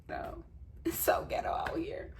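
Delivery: whispered aside; hushed confession to camera. Real, not acted. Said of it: "unvoiced whisper".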